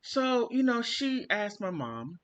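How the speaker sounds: ordinary talk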